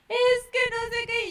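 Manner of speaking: high, whining imitation of a tearful child; mock-cry it high like a little kid. Crying child complaining to mom, mock wailing. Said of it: "llega el niño llorando".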